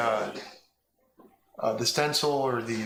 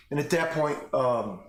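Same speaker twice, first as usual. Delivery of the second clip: ordinary delivery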